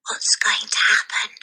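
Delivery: breathy schoolgirl whisper. A narrator's reading. Lavender whispers to Matilda in assembly. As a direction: whisper it so the teacher can't hear. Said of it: "Lavender whispered".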